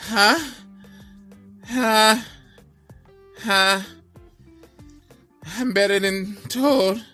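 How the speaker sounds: very high, loud, gasping boast; breathless bawl. Acted. Exhausted Donkey at the fifth post, breathless high bawl. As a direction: push the boast out with little wind left. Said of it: "tired and out of breath".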